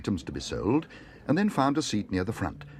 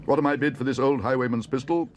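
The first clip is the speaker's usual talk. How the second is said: higher, louder call to the hall; auctioneer calling; 6 semitones higher, 4 dB louder than usual. Acted. Auctioneer calls for bids on the pistol. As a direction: sell it to the crowd. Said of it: "called the auctioneer".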